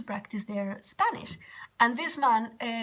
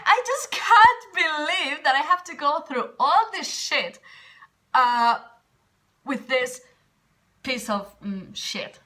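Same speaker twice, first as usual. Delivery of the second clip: exasperated outburst; 5 semitones higher, 7 dB louder than usual. Retold or imitated. Retold outburst about insulting man.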